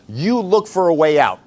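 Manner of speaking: ordinary talk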